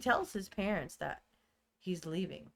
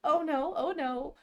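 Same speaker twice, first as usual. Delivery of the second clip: mock panic; 8 semitones higher, 3 dB louder than usual. Mom panics after slap.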